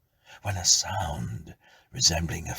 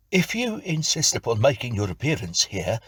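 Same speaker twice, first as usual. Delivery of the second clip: higher and louder, steadied; composed tenant; 6 semitones higher, 5 dB louder than usual. Acted. Tenant regaining composure, speaking up. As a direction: answer with forced composure.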